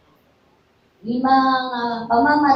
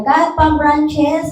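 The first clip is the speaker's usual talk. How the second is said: "Cut palm branches" spaced out, slow and raised; slow emphatic command; 6 semitones higher, 8 dB louder, much slower than usual. Retold or imitated. Pastor quoting the verse's order to the church, slow and emphatic. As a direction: lay weight on every word of "Cut palm branches". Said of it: "sabi niya".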